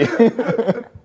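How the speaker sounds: a real burst of high laughter. Real, not acted. Laughs at own plan. (risa aguda y real)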